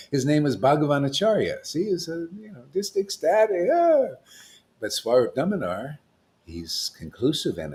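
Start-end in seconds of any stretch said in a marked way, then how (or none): none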